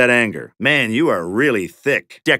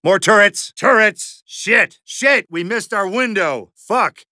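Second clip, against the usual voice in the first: panicked yelling; 7 semitones higher than usual. Real, not acted. Panicked combat callouts.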